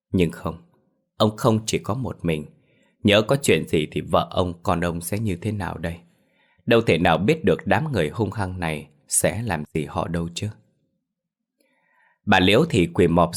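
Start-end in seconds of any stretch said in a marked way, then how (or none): none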